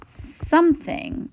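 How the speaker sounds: high and drawn out. Shown as a demonstration. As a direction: stretch it up high, slowly. Teacher stretching the unknown minutes.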